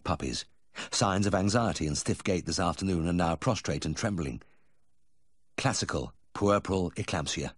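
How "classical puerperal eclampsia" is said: usual speaking voice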